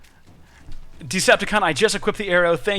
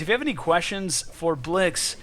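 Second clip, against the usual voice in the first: normal talking voice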